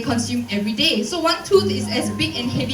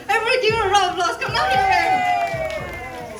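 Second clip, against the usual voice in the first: fast, high, stretched-out call; rally the crowd with delight; excited call; 8 semitones higher than usual. Real, not acted. Excited call to audience winner.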